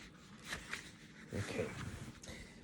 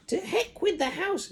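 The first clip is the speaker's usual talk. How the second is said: put-on old screechy voice. Grandma calling down from the attic, screechy. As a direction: screech from far above like a hag. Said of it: "she shouted".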